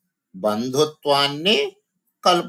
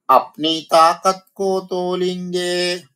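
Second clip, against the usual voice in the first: raised, sing-song recital of the line; poem recitation; 7 semitones higher, 4 dB louder than usual. Shown as a demonstration. Teacher reciting the poem line to her students, sing-song. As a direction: chant the verse to the class.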